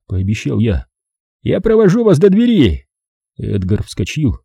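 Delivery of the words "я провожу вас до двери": put-on higher polite young man; eager young man, higher. Edgar voice.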